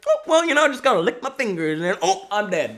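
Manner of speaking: high put-on old-man voice; mock a clueless old fellow, high then dropping; impression of elderly guy. Old guy about to lick his fingers in the store, put-on elderly voice. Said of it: "that's my impression of that guy".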